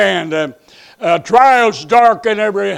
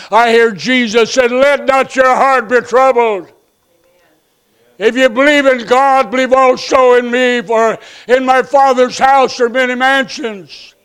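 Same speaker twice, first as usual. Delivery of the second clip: raised, higher preaching voice; preaching Jesus' words; 5 semitones higher, 4 dB louder than usual. Acted. Pastor proclaiming Jesus' promise of mansions to the church.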